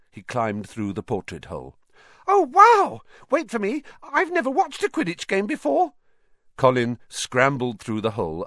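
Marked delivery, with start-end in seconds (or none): 2.3-5.9 very high, loud piping squeal; thrilled schoolboy fan. A narrator's reading. Colin begs to tag along to practice, thrilled. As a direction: burst with excitement at your hero.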